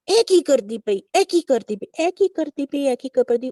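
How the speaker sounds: mild imitation of Guruji's loving tone. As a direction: echo Guruji's fond scolding in Punjabi. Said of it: "उसी तरह जैसे गुरुजी ने".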